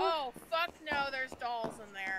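scared. Real, not acted. Dolls found in horror game.

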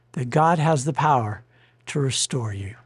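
ordinary talk, nothing marked

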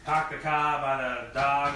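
put-on Boston accent, broad dropped Rs. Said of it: "Do a Boston accent".

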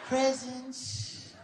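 high soft Michael, dreamy. Retold or imitated. Impersonating Michael charming Chris about his name, high and soft. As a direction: drift off sweetly like Michael, slow and airy.

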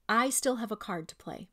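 usual speaking voice

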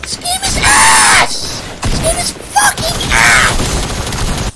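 high, slow furious scream; raging gamer. Real, not acted. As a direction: scream it with a cracking voice. Gamer screaming that game is bad.

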